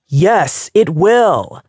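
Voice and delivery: yell. A narrator's reading. Kat overruling Diana, yelling. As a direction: bellow it to end the argument. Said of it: "He yelled this so loudly".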